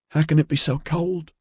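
put-on high-pitched, miserable boy's voice; wretched boy. A narrator's reading. Freezing boy asks his companion about the cold, wretched. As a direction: plead wretchedly, pitched well up. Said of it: "the boy asked wretchedly".

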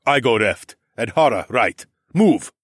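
loud, curt orders, last word highest; barked orders. Acted. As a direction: command the squad in battle. Min Jun gives battle orders.

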